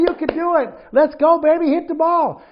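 high, loud cheering from the bleachers; excited sideline mom. Retold or imitated. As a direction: yell encouragement at the batter.

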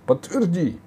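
high-pitched urgent appeal; pleading girl. A narrator's reading. Girl begging the warrior to confirm, pleading. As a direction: implore him in a high voice. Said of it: "взмолилась Алиса".

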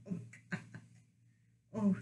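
ordinary talk

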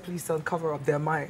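low, hushed retold plea; confidential plea. Father asking son to cover for him, hushed. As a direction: keep it down, begging a secret favour.

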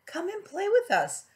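high playful calling voice; lightly voiced little fish calling. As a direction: call out brightly to a friend. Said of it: "they would call".

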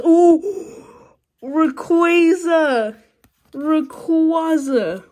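loud, high thrilled yelling of the name; excited shout. Real, not acted. Kid opening packs shouts at his rare pull, thrilled and loud. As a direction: shout it out like you just hit the jackpot. Pulls a rare card, yells.

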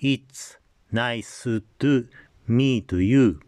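slow katakana English with added vowels; Japanese-accented English. Shown as a demonstration. Teacher showing own English before the native model, Japanese accent. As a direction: say each word apart in a Japanese accent. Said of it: "私の日本語、英語を聞いていただいてから".

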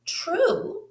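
high, sweet dinosaur voice; bright high answer; Bronterina replying. Acted. Teacher reading Bronterina's reply in a high voice. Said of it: "Bronterina replied".

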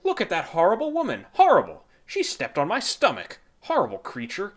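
loud, high indignant outburst; outraged cry. A narrator's reading. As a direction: cry out in shocked outrage at her. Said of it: "she cried".

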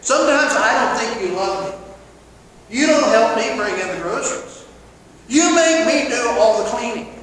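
guilt-trip your spouse out loud; whiny accusation. Acted. Spouse nagging their partner, loud accusing whine. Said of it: "that's emotional manipulation".

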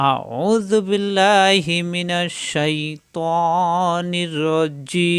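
slow, drawn-out chanted recitation; Quranic recitation. Real, not acted. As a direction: recite each word long and melodic, like tilawat. Opening recitation before the lesson. (ধীর, টেনে টেনে কোরআন তিলাওয়াতের সুর)

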